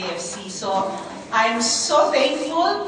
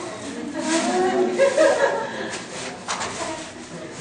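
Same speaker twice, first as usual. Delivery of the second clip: light high giggle; soft laugh; 6 semitones higher, 4 dB quieter than usual. Real, not acted. Speaker giggles on spotting familiar faces in the crowd.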